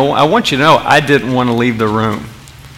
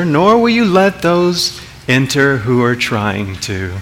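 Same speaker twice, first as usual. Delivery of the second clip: slow, lilting and mild, with long pauses; peaceful, nice Jesus; slower than usual. Acted. Preacher imitating nice Jesus rebuking Pharisees, slow and lilting. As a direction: deliver a rebuke as soothingly as a children's host. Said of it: "can you imagine the peaceful and nice Jesus saying this?".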